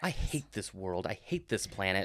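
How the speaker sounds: low, soft grumble; mock despair. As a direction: sulk quietly in playful defeat. Mock despair that magic isn't real.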